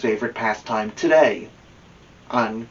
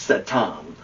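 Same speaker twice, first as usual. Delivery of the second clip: usual speaking voice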